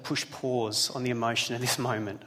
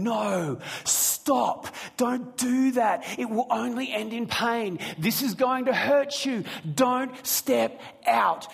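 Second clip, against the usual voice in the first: much higher, slow, urgent pleading shout; screaming warning; 9 semitones higher, 3 dB louder, slower than usual. Retold or imitated. God screaming at us to stop, urgent shouting. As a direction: cry out to stop someone stepping into traffic. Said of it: "God would scream at us saying".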